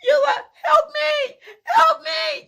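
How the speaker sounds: high, loud panicked cry for help; screaming for help. Retold or imitated. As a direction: scream to Eula as the bull charges. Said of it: "I'm screaming at Eula".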